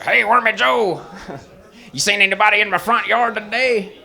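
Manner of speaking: high, ugly caricature voice; Lee. Acted. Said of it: "So was his voice. He talks like".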